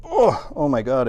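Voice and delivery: straining groan while lifting; effort grunt. Real, not acted. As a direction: grunt it out under a heavy load. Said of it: "sorry if I'm grunting and groaning".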